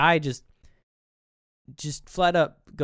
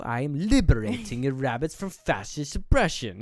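hammed-up proclamation, a little louder; mock-righteous declaration; 2 semitones higher, 4 dB louder than usual. Vasily answers the man about his rabbits, mock-righteous. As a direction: declaim it like a mock freedom fighter.

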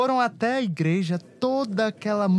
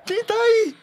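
scared call; 13 semitones higher, 7 dB louder than usual. Acted. Scared call into dark house.

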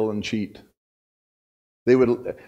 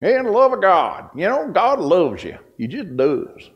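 Laddie McDonough; 5 semitones higher, 3 dB louder than usual. Retold or imitated. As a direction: imitate a folksy Missouri farmer preaching. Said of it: "That's how he sounded".

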